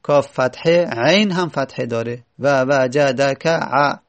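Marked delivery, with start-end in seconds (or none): 2.4-4.0 و وجدک ع said slowly to count six fathas; slow it down so each fatha counts; slow counting demo. Shown as a demonstration. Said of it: "چند تا شد". (آهسته برای شمردن)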